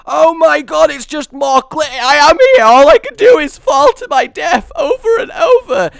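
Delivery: very high, loud theatrical wail; hammed-up cry of hopeless horror; mock despair. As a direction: wail like a doomed victim. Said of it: "they come out here and they're like".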